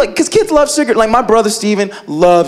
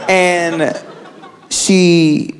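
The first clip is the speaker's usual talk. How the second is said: ordinary talk, nothing marked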